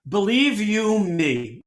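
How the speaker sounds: put-on slow Russian-grandmother accent, drawn out. Russian grandmother stressing what she tells you, slow accented imitation. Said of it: "my Russian grandmother".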